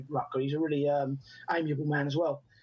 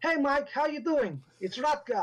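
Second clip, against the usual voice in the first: higher, drawn-out imitation Dutch accent; Rutger Hauer; 7 semitones higher, slower than usual. Actor greeting director by phone, imitated Dutch accent. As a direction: mimic a Dutch caller's friendly hello. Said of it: "I was mimicking what I was going".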